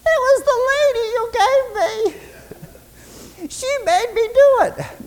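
very high, whiny mock crying; whimpering Adam. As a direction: whimper the excuse in a squeaky wail. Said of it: "starts to whimper and he says".

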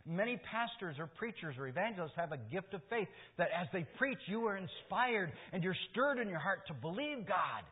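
rising sermon fervor; fervent preaching. Acted. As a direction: press it home from the pulpit. Preaching at full force.

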